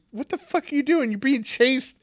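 high-pitched incredulous scolding; exasperated, pitch jumping right up. Retold or imitated. Viewer reacting to a movie character's blunder, exasperated.

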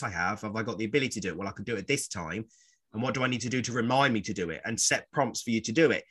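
ordinary talk

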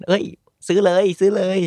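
high, eager retold urging; urging a friend. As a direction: egg a friend on to buy. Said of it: "ผมก็บอกเพื่อน".

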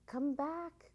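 high, loud call. A narrator's reading. Boy calls after the children entering the mountain, calling out. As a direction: call them back from afar. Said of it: "called the boy".

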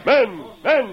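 loud call for the crowd's attention. Acted. Masked man calling the crowd to order, loud and commanding. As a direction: raise the voice over the mob.

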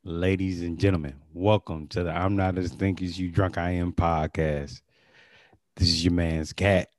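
low, deliberate podcast announcer. Shown as a demonstration. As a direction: open the show like a radio host. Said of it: "I can just do it the regular way".

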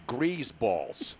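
low, quiet "grease balls" with a z; "grease balls" said as "greazeballs", deadpan and low; Pesci-style insult. Retold or imitated. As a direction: drop "grease balls" as a low verdict, soft z. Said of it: "that's the way it should be used".

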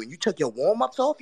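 usual speaking voice

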